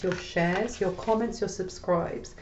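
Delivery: normal talking voice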